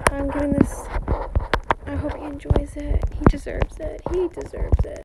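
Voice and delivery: hushed whisper to herself. Real, not acted. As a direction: whisper it so nobody hears. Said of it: "whispering while trading".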